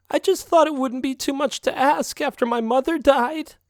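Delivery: high, tearful cat wailing over her mother; crying. A narrator's reading. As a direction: fake tears, pitched high and pleading. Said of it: "Then the crying began".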